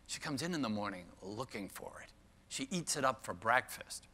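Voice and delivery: quiet, dry retort; understated imitation of an incredulous director; retold eye-rolling disbelief. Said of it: "kind of rolled her eyes and she said".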